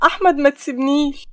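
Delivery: loud, distraught begging; distraught plea. Acted. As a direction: plead as if falling apart. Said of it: "فريدة وهي منهارة". (توسّل بانهيار وصوت عالٍ)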